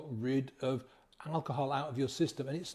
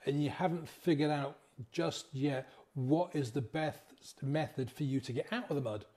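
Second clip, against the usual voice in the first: normal talking voice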